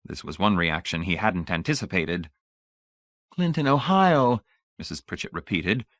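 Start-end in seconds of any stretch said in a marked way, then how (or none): 3.3-4.4 slow, high, louder delighted echo; repeating with vapid pleasure; bland delight. A narrator's reading. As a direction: savour the name vacantly. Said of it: "retaining her bland delight".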